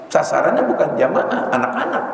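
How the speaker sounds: ordinary talk, nothing marked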